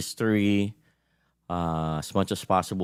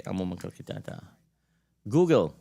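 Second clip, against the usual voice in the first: ordinary delivery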